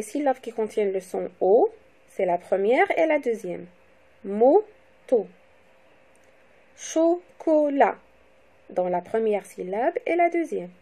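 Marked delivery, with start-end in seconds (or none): 4.2-5.3 "Moto" split into mo, pause, to; syllable by syllable. Shown as a demonstration. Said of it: "le découpage syllabique". 6.8-8.0 "Chocolat" slowly split cho, co, lat; teacher's slow cho-co-lat breakdown of "Chocolat"; syllable by syllable. Shown as a demonstration. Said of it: "le découpage syllabique".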